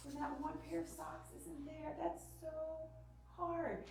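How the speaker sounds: hushed, soothing sympathy; soft empathy. Retold or imitated. Mom feeling with her upset daughter, tenderly empathetic. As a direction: comfort a child softly, drawing out the feeling. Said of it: "I had to feel with her".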